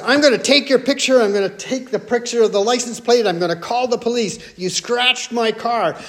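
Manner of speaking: fast, high-pitched retold threats; mock yelling. Woman threatens wife in parking lot, mock yelling. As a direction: rattle off threats like an outraged accuser, then drag out the charge. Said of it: "this yelling match".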